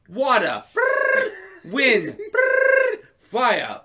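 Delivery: loud calls, each "brrr" buzzed high; hammed-up element roll call; mock ring summon. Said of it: "He's like".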